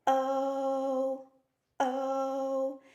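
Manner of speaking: oh called out high and loud, held steady; letter name o. Shown as a demonstration. Letter finally screaming its own name, loud sustained oh. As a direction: proudly announce the long oh. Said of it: "it gets to say".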